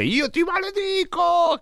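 high, slow mock curse; shrill-free high wail of a curse; imitating the cursing lady. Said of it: "Vi ricordate che disse".

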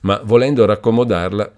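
ordinary delivery, nothing marked

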